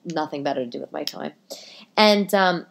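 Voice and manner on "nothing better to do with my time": hushed, sarcastic whisper; a dry whispered joke to listeners; whispered aside. Real, not acted. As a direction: whisper it like a guilty confession.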